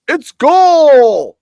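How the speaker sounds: booming high ring announcer call; boxing ring announcer. Retold or imitated. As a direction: belt out the name like a ring announcer. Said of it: "the other girl says".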